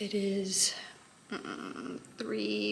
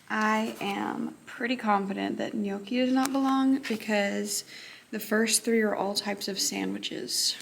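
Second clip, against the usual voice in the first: ordinary talk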